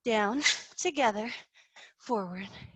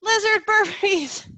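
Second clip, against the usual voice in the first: excited announcement; 7 semitones higher, 4 dB louder, slower than usual. Real, not acted. Coach announces next exercise.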